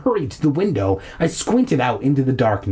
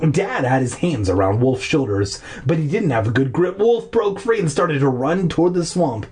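usual speaking voice

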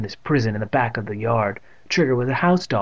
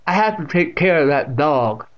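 louder, higher deaf woman; stern old aunt; 4 semitones higher, 5 dB louder than usual. A narrator's reading. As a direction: announce it sternly. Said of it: "Catherine said sternly".

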